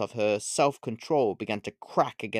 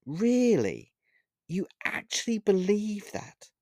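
high, incredulous question; surprised; 9 semitones higher, slower than usual. A narrator's reading. Witch doubts Olivia's claim of innocence, surprised. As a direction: marvel at her in a raised pitch. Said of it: "asked the witch with surprise in her voice".

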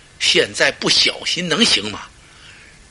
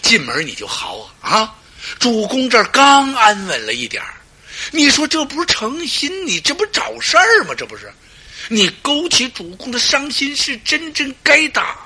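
scolding; 9 semitones higher than usual. Acted. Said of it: "心说".

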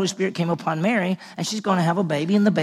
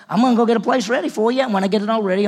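eager promise; 6 semitones higher, 6 dB louder, much faster than usual. Acted. Preacher voicing Jesus's promise.

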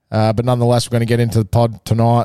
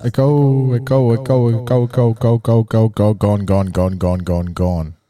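digital echo; 2 semitones lower, slower than usual. Shown as a demonstration. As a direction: echo each word and let it die out. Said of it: "Hear that echo?".

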